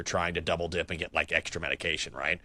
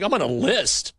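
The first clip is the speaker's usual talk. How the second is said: retold shock; 9 semitones higher, 4 dB louder than usual. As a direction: pitch jumps up high in disbelief. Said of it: "I'm like".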